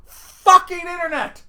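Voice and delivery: high, loud, slow curse at the internet; exasperated outburst. Retold or imitated. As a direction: spit it out in disbelief.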